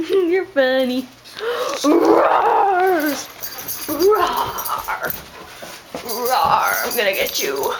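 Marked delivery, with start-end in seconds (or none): 1.8-5.1 long, drawn-out monster roar; playful roar. Pretend. Playing monster with the dog. 6.0-7.7 low growly mock-monster threat; playful monster.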